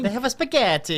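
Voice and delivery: joking mock Italian accent; put-on Italian accent for a food joke. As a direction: play at being a comic Italian. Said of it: "in an Italian accent".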